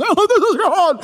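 high, loud, hammed-up TV deity; God on TV. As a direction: boom it out like a cheesy TV God. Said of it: "those T V shows make us".

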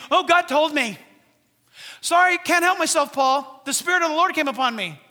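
loud, high mock excuse; self-important believer. Church member excuses outbursts to Paul, mock-pious. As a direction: proclaim it like an over-spiritual church member. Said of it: "you're saying things".

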